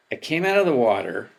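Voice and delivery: normal talking voice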